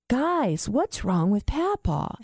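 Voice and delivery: lightly imitated puzzled child; little boy. Grandson asking family about Papa, lightly childlike. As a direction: ask slowly, like a concerned kid. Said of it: "Tyler was little".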